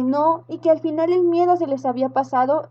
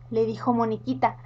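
ordinary delivery

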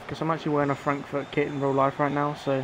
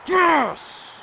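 huge high loud yes; real joyful cheer; goal celebration; 13 semitones higher, 4 dB louder than usual. Real, not acted. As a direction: explode with relief at the goal. Long-awaited goal scored.

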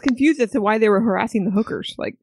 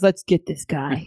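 creepy; 5 semitones lower, slower than usual. Retold or imitated. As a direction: say it low and slow like a creepy killer. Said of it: "And he also very creepily says".

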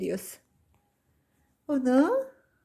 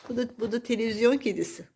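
normal talking voice

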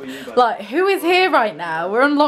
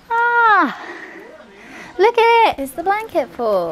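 high, loud delighted reveal; excited; 12 semitones higher, 4 dB louder than usual. Real, not acted. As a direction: show it off, thrilled. Showing off the blanket fort.

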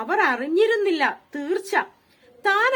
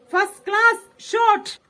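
put-on lightly raised boyish call across the yard; child calling out; 3 semitones higher, 3 dB louder than usual. Boy shouting to friends at play, a mild excited call. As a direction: call it out like a boy cheering a good shot. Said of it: "ഉണ്ണി വിളിച്ചു പറയുകയാണ്".

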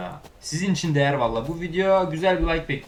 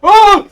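very loud, high startled yelp; short, piercing shriek of surprise; startled scream; 22 dB louder than usual. Real, not acted. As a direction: scream out in sudden fright. Startled by chasers in game.

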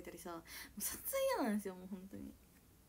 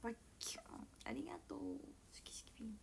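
playful whisper; 12 dB quieter, faster than usual. Real, not acted. Whispered playful replies to viewers.

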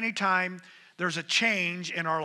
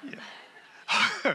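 breathy laughter; 8 semitones higher, 5 dB quieter than usual. Real, not acted. Audience joke about marriage.

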